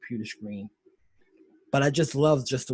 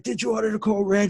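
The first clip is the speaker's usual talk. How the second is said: demanding "Did you order the code red?" loudly and higher; shouted demand; 10 semitones higher, 8 dB louder than usual. Retold or imitated. Lawyer yells the code red question at the colonel. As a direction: fire the question at him, hard and loud. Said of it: "And he's like".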